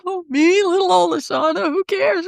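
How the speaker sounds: high, breezy mock shrug of a voice; imagined dismissive star. Imagining the famous pianist shrugging her off, high and breezy. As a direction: toss it off like it means nothing. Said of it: "He's like".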